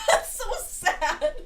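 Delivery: high, loud, drawn out through laughter; slow, squealed amused pity; laughing sympathy. Real, not acted. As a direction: laugh out a drawn-out pitying reaction. Laughing at the baby story.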